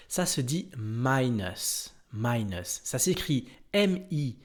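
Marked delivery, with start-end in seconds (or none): 0.8-2.8 minus as "MY-nus", stress on the first syllable; slow, careful minus with the "my" sound; English minus. Shown as a demonstration. Teacher modelling minus slowly for French learners. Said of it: "Écoutez bien".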